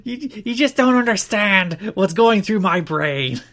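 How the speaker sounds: hammed-up slow, high wrestler voice; theatrical tortured promo, easing down at the end; Raven. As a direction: drag out the mock anguish, starting loud. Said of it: "Please do it in the voice".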